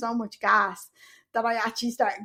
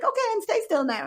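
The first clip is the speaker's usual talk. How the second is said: much higher, sing-song mock anaesthetist; mock calm doctor; 11 semitones higher than usual. Mimicking the anaesthetist telling her to stay still. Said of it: "your man was just like".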